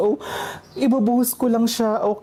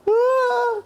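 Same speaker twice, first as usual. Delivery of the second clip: startled scream; 10 semitones higher than usual. Real, not acted. Flame flares up.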